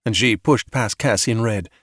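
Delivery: usual speaking voice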